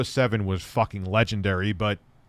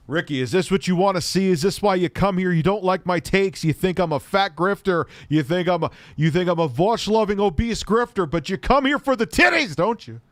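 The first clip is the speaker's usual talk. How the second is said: mock indignation; 7 semitones higher than usual. Mock-offended rant at a viewer.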